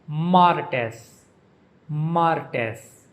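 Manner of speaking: "martes" with the weight on MAR; MAR-tes, "martes" falling after a strong first syllable; stress on first syllable. Shown as a demonstration. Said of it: "emphasis on the first syllable".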